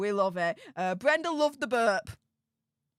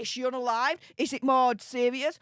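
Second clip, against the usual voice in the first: usual speaking voice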